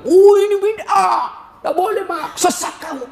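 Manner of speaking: hammed-up pious scolding; mock zealous youth. Young convert accuses his mother of heresy, mocking.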